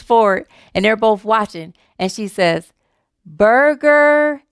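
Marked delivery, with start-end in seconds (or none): 3.3-4.4 over-enunciated, stretched-out syllables; slow and clear. Retold or imitated. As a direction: spell it out slowly, as a punchline. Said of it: "say it slowly and clearly".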